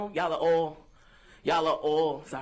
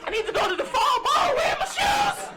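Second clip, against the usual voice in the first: high-pitched frantic wail; hammed-up freak-out, way up high; mock panic; 4 dB louder than usual. Stoned woman hunting her lost shoes, mock panic.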